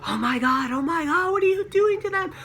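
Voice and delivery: very high, rising panic in a half-yelled squeal; retold panicked screaming. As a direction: shriek it at the attacker in horror. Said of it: "like, screaming".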